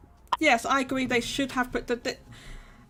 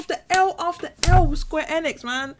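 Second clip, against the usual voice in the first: frustrated rant; 7 semitones higher, 7 dB louder than usual. Real, not acted. Venting about Square Enix.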